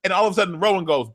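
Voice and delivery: ordinary delivery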